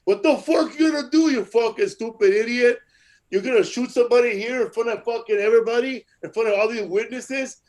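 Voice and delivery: loud, high, furious heckler comeback; yelling down a gunman in the club; Mencia yelling. Retold or imitated. Comedian Mencia yelling at the gunman, high and loud. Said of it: "Mencia tell him".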